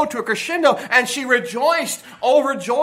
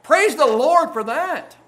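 higher, slow, emphatic exclamation of praise; exuberant praise; 6 semitones higher, 3 dB louder, slower than usual. Acted. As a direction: burst out in hearty church praise. Acts out hearty praise, against stogie-ish.

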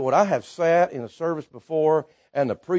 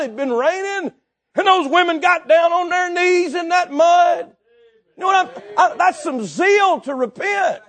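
high, loud revival preacher; full-force preaching; 9 semitones higher, 7 dB louder than usual. Real, not acted. Preacher telling a congregation about dramatic conversions, full-force preaching.

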